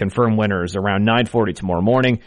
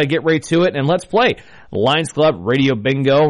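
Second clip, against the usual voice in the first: ordinary talk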